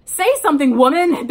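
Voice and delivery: high, loud yell. Acted. Ben yells at the silent bandleader, loud and high. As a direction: call her out across the group. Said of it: "Ben yelled".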